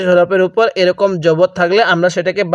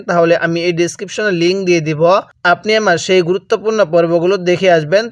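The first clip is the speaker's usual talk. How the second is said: ordinary talk, nothing marked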